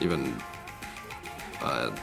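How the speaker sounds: ordinary talk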